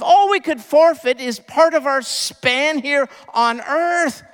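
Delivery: loud, high, impassioned sermon voice; preacher rising to a passionate climax; fiery preaching. Acted. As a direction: proclaim it to the whole room. Preaching at full force.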